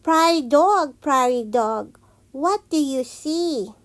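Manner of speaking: plain reading voice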